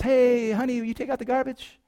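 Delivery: high, singsong mom voice; mom calling. Acted. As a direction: call sweetly up to your child. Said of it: "your mom says".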